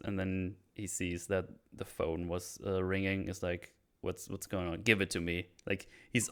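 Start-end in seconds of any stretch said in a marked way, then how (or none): none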